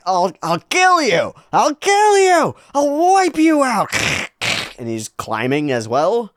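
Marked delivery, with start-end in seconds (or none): 0.1-3.9 high, loud screaming threats; berserk rage. Acted. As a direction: scream each threat in frenzy.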